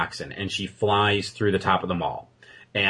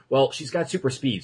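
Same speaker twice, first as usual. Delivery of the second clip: normal talking voice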